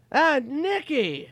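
very high, loud, stretched-out cry; Norm Macdonald. Retold or imitated. Norm greeting his texting buddy, exuberant high impression. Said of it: "he goes".

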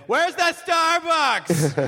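high, slow, drawn-out tourist call; stretched-out mock American shout. Mimicking loud American tourist.

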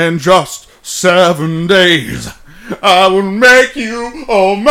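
high, slow theatrical villain; Tim Curry. Retold or imitated. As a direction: purr it out slowly like a stage villain. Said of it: "That's what he says in that movie".

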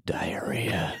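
hushed, soft whisper. Shown as a demonstration. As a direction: whisper the embarrassing word. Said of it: "what are you gonna whisper".

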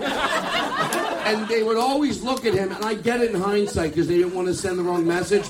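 normal talking voice

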